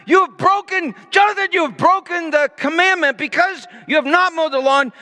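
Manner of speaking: put-on over-the-top father scolding his teenage son; screaming father. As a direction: play the furious dad, high and shouting. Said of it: "I just began to scream".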